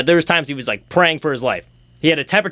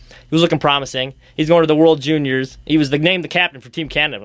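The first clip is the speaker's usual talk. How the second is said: ordinary talk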